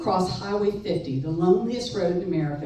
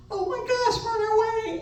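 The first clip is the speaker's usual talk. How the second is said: mock excited squeal. Retold thought at trip start.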